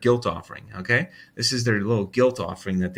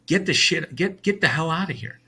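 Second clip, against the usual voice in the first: angry brush-off; 6 semitones higher than usual. Retold or imitated. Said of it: "I'd be like".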